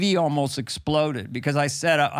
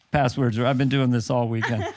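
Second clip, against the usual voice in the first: usual speaking voice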